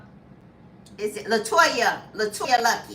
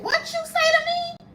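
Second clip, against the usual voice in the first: sharp, much higher and offended; offended wife. Retold or imitated. Wife, stung by her husband's menopause jab, high offended comeback. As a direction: snap back like she's insulted. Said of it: "She said".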